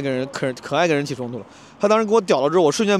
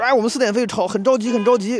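着急的插队男人; 7 semitones higher than usual. Retold or imitated. 插队男人急着说四点飞.

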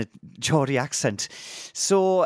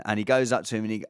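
ordinary talk, nothing marked

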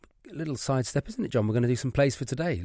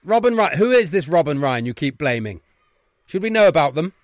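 exasperated headmaster; 3 semitones higher, 7 dB louder than usual. Acted. Headmaster to boys blaming someone else, exasperated. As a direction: challenge the excuse at full voice. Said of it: "One is the Wrexham Headmaster's Office".

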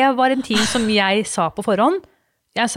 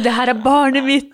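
tearful; 4 semitones higher, 4 dB louder than usual. Retold or imitated. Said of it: "og gråt".

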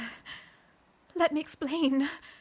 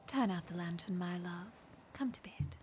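hushed, tender bedroom whisper; soft whisper; 12 semitones higher, 7 dB quieter than usual. Acted. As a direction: coax him softly to bed. Wife coaxing husband to bed.

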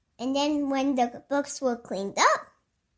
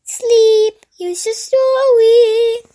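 high, loud, stretched-out show intro; show announcer; 11 semitones higher, 10 dB louder, slower than usual. Acted. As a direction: announce story time loudly, drawing out the words. Story time intro.

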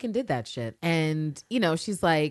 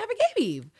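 mock exclamation. Acting out her exclamation.

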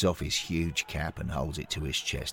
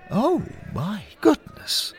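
amazed; 4 dB louder, slower than usual. Acted. Friend reacts to goal.

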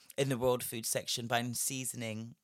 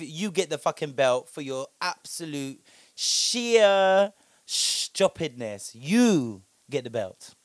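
emphatic award; 8 dB louder, slower than usual. Acted. Awarding the belt.

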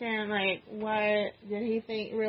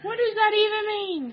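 incredulous; 10 semitones higher than usual. Real, not acted. Repeated incredulous question.

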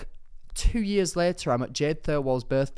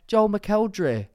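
attempted Newcastle accent; 9 semitones higher, 5 dB louder, slower than usual. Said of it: "that's my attempt at a Newcastle accent".

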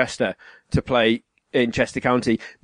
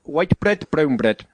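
South African accent; slower than usual. Shown as a demonstration. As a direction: imitate South African vowels.

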